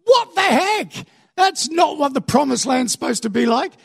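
high-pitched mock outrage; yelling at God. Israelites disappointed by the promised land, yelling at God. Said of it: "then they yell at God".

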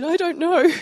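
high, loud, bewildered little voice; teen me. Retold or imitated. Teen answering her youth leader, high and helpless. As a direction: sound like a confused young teen. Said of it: "I said".

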